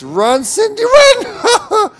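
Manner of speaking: high, loud yell breaking into laughter; urgent shout. Real, not acted. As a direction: shout at your teammate to flee. Urging teammate to flee zombies.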